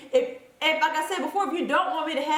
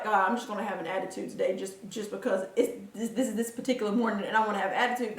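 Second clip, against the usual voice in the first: lower, softer, quick grumbling mock grouch; grumpy morning attitude; 4 semitones lower, 5 dB quieter, faster than usual. Mocking her imagined grumpy morning self, low grumble. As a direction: mutter it like a sulky grouch just out of bed. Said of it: "I don't wake up in the morning and be like".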